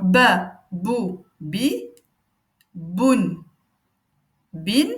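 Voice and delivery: "ba", "bou", "bi", "boun", "bin" spaced out with pauses; model syllables. Shown as a demonstration. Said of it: "je vais venir prononcer chaque lettre".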